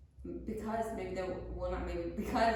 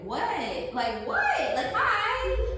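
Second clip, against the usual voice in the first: awkward hi; 8 semitones higher, 4 dB louder, much slower than usual. Retold or imitated. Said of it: "that's what I would say in the mornings".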